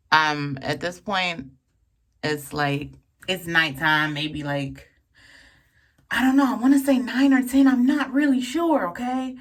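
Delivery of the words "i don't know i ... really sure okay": excited rush. Real, not acted. Excited aside.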